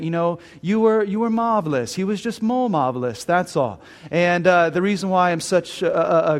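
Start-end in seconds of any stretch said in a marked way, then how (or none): 0.6-3.8 imitated British woman's accent. Woman consoling the beaten runner in the stands, British accent. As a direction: put on an amateur British accent. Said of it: "in her British accent".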